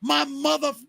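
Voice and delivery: hammed-up rude customer snapping; retelling a rude customer's shout, mildly; retold angry outburst. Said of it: "And he was like".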